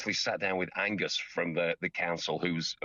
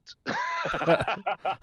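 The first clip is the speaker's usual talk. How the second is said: laugh; 12 semitones higher, 3 dB louder than usual. Real, not acted. Embarrassed laugh.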